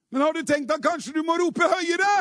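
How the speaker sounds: high, rising preacher's shout. Real, not acted. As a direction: fire the challenge at the congregation, voice lifted high. Preacher urges congregation to shout louder.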